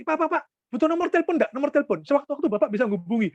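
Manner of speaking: high, fast, insistent sales pitch; imitated pushy tout. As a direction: mimic someone chasing a departing customer. Imitates youth insistently pitching after car.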